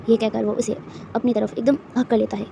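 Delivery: ordinary delivery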